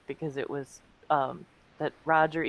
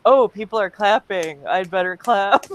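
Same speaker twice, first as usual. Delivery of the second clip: mock dazed wife; 3 semitones higher, 7 dB louder than usual. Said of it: "she looks around and goes".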